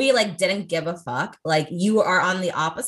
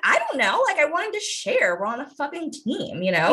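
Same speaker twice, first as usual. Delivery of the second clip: put-on much higher, pleading little girl; whiny kid reply; 8 semitones higher than usual. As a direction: plead innocently like a small child. Young self defending sharing.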